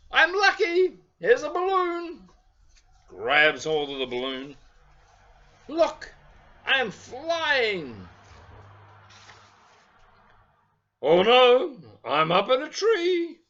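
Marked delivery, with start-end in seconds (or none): 0.1-2.3 much higher, louder little bear voice; storyteller voices Lucky for children, high and eager. Acted. Lucky finds balloon. 5.7-13.4 much higher, louder little bear voice; storyteller voices Lucky for children, high and eager. Acted. Lucky flying, stuck.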